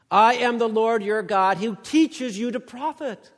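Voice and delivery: loud, high preaching voice; forceful scripture reading. Acted. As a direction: read God's words like a summons. Reads verse seventeen onward.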